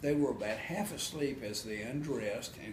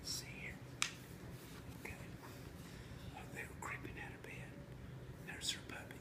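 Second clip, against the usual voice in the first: whispering; 14 dB quieter, much faster than usual. Real, not acted. Quiet aside to child.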